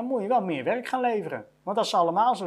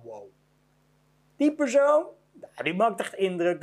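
mock impressed wow; 3 semitones higher than usual. Imitating an impressed boss.

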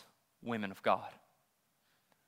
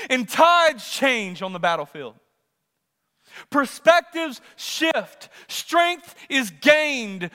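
high, loud rallying preacher; full-force preaching; 11 semitones higher, 10 dB louder than usual. Acted. Preacher rallying families to win the household, full-force preaching.

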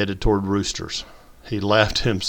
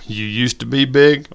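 teasing jab; 5 semitones higher, 6 dB louder than usual. A narrator's reading. Old man jab.

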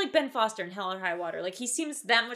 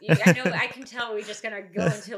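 usual speaking voice

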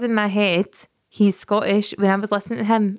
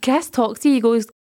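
normal talking voice

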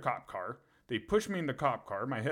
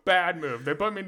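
louder, higher, laughing through words; 7 semitones higher, 8 dB louder than usual. Real, not acted. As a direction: say it while still laughing. Still laughing after big laugh.

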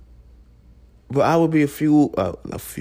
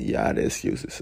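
breathy whispered repeat, much softer and lower; whispered echo; 6 dB quieter than usual. Shown as a demonstration. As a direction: whisper the line back like an echo. Echoing his own line as aside.

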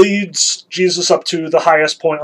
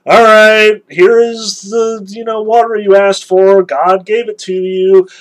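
higher and louder, sarcastic; grudging sarcasm; 5 semitones higher, 6 dB louder than usual. Retold or imitated. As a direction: hand it over with a sneer. Moses grudgingly handing over water.

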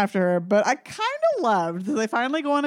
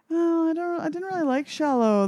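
higher-pitched imitation of a host; mocking radio host; 7 semitones higher than usual. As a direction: mimic a dithering radio presenter. Imitating a radio host's opinion.

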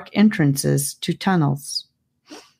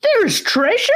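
put-on much higher, squeaky thrilled boy; bursting with excitement. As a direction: blurt it out, eyes lighting up. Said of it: "burst out with excitement".

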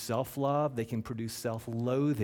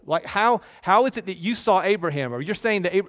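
loud, higher disbelieving retort; incredulous challenge; 9 semitones higher, 10 dB louder than usual. Retold or imitated. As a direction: scoff at him in disbelief. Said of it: "And then they say".

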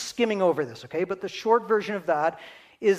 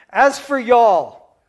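slow, louder, drawn-out Texan "y'all"; attempted Texan drawl; 4 semitones higher, 10 dB louder, much slower than usual. Shown as a demonstration. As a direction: stretch out "y'all" like a Texan, half-jokingly. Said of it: "So I'll try that. It probably won't sound as good from me".

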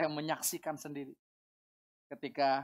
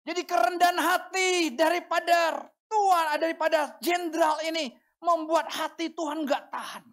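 high, loud, impassioned preaching; preaching at full force; 9 semitones higher, 7 dB louder than usual. Real, not acted. Preacher exalting the general's humility, full-force proclamation. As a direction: proclaim it loudly to the whole congregation.

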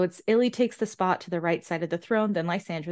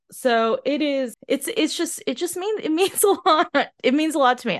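struggling to get the words out; choked up; 7 semitones higher, 4 dB louder than usual. Real, not acted. Fan says the ending means a lot, choking up. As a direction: voice jumping high, breaking with a laugh. Overcome while summing up.

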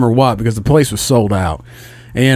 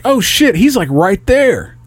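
higher pitched, drawn-out amazement; excited realisation; 8 semitones higher, slower than usual. Retold or imitated. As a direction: act out spotting a star right there. Reacting to star appearing in retold story.

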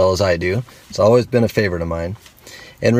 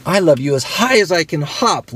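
much higher, bouncy little bunny voice; playful little hare, pitched up; laughing little hare; 9 semitones higher, 3 dB louder than usual. Acted. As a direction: call it out like a hare mid-hop. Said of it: "laughed Little Nut Brown Hair".

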